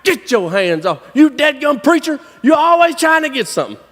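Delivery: high, mock-angry holler. Buddy catching the preacher taking his ropes, hollering. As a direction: holler like you caught a thief. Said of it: "And he hollers at me".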